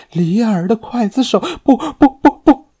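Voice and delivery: high, loud terrified scream; screaming in terror. A narrator's reading. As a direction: shriek in horror. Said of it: "尖叫起来".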